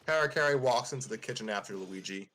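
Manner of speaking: ordinary delivery, nothing marked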